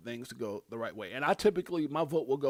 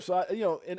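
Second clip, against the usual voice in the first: ordinary talk, nothing marked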